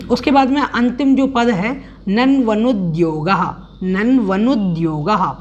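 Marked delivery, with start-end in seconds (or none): 2.1-5.4 "नन्वनुद्योगः" slow, each syllable clear, final visarga breathed; careful pronunciation. Shown as a demonstration. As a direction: model "नन्वनुद्योगः" slowly. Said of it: "इसका उच्चारण करना है".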